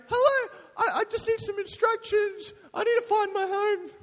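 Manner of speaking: high, put-on lost voice; lost stranger act. Knocking on a steamed-up car window, high silly lost voice.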